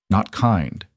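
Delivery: ordinary delivery, nothing marked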